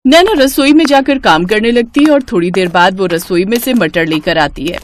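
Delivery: usual speaking voice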